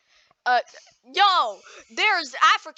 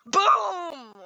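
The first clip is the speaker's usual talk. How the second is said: explosion noise; 6 semitones higher than usual. Pretend. Crash sound effect.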